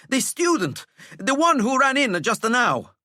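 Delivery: breathless prefect forcing words out; winded and panting. Acted. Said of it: "stop your panting".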